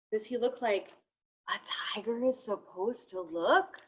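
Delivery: hushed conspiratorial whisper. Acted. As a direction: lean in and whisper it. Whispers question to kids.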